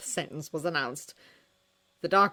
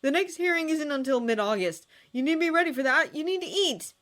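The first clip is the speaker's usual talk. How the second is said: high pleading plea, louder; begging a starving teen to eat, pitched high; 10 semitones higher, 4 dB louder than usual. A narrator's reading. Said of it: "Jason begged".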